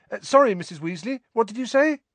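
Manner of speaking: high, quick, distracted boy; distracted apology. A narrator's reading. Harry to Mrs Weasley, having missed it, distracted. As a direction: break off from the game to ask, high-pitched.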